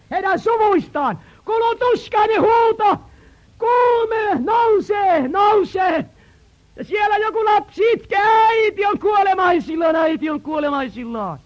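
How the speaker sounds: high, loud anguished preaching; sermon climax, loud and high; shouted preaching. Acted. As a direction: shout out the suffering of the camp. Narrating the camp's anguished cries.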